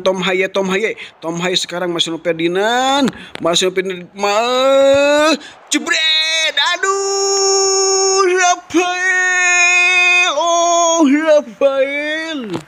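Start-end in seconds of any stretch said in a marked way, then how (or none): 4.1-12.6 high, sung-out cry of Rafael; near-miss cry. Real, not acted. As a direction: wail the name at the near miss.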